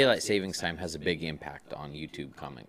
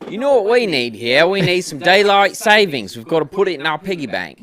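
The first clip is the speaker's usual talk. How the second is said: put-on Kiwi accent; 11 semitones higher, 15 dB louder than usual. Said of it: "thus is more of a Kiwi accent".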